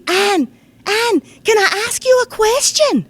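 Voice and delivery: put-on high, loud child's voice calling Anne in a Scots accent; wee Scottish boy. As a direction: call out like an excited wee Scottish lad tugging at her. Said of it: "I'm going to do it in the Scots dialect".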